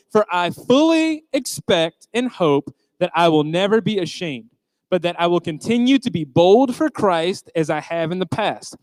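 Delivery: slow, measured reading aloud together; second slow recital of the verse; group scripture reading. Shown as a demonstration. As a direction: lead the crowd through the verse slowly. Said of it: "we're gonna read it out one more time".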